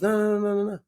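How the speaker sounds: lightly voiced director waving away a worry. Director dismissing an actor's worry about his accent, brisk overruling. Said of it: "I remember the director goes".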